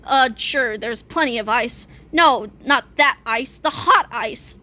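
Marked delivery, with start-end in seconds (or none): none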